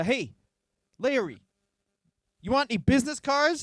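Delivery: high, breezy cold call; a hint of a pushy phone seller; imitating salesman. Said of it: "And he went".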